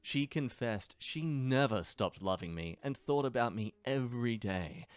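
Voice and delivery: ordinary talk, nothing marked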